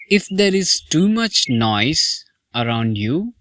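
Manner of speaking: ordinary talk, nothing marked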